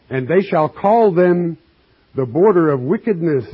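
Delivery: scripture reading. Acted. Preacher reading God's verdict to the congregation, declaiming.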